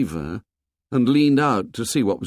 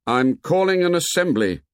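louder second call; loudly; 7 semitones higher than usual. A narrator's reading. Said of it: "Ralph spoke again, loudly".

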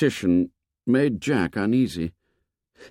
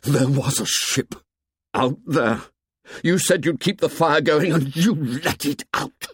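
shaking voice; 4 semitones higher than usual. A narrator's reading. Ralph accusing Jack, voice shaking. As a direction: let the fury make the voice tremble. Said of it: "his voice shook".

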